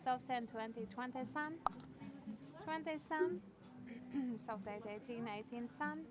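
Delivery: normal talking voice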